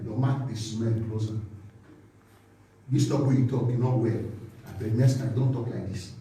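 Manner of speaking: low, softened advisor's voice; hushed, low-pitched private telling-off; quiet admonishing. Acted. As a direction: take them aside and correct them low and quietly. Acting advisors correcting someone privately.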